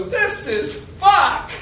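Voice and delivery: put-on high, loud, drawn-out kid's exclamation; triumphant blurt. Six-year-old boasting to playmates over Monopoly, triumphant high shout. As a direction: crow it out loud and slow.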